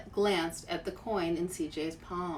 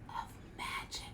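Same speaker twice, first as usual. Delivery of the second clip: hushed whisper; 13 dB quieter than usual. A narrator's reading. Storyteller to listening children, reaching the magic in a whisper. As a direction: breathe the words out in a whisper. Climax of the music passage.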